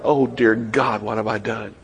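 low, hushed, stricken cry to God; Peter's anguish. Acted. Peter as the rooster crows, hushed and stricken. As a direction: let the guilt sink the words to a near hush. Peter realises his betrayal.

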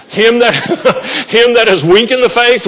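reading "Him that is weak in the faith" with a laugh; laughing through verse. Real, not acted. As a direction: giggle while reading.

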